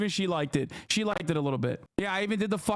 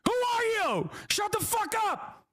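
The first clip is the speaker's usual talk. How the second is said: hammed-up yell, pitched way up; mock shout at him; 10 semitones higher than usual. Streamer replaying his TV outburst in a high mock yell. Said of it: "I was like".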